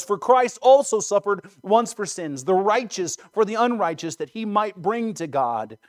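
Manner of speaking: fervent, ringing recitation of scripture; impassioned scripture recitation. A narrator's reading. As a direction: declaim the prophecy with swelling force. Quotes Peter at preaching force.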